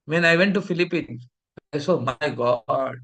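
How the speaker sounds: usual speaking voice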